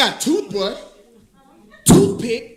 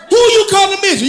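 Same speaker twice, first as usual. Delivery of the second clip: loud, higher indignant shout; mock indignant; 7 semitones higher, 7 dB louder than usual. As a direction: snap back offended. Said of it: "I'd be mad".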